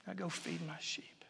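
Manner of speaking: whispered, tender go feed my sheep. Acted. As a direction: send him off in a soft whisper. Jesus gently recommissions Peter.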